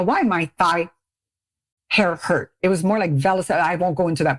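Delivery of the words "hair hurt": ordinary talk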